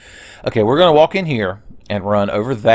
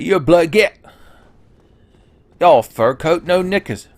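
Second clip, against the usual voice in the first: higher, louder mock Cockney bloke picking a fight; 8 semitones higher, 4 dB louder than usual. Streamer voices a game character as a Cockney tough, mock Cockney. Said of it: "like, Cockney British now".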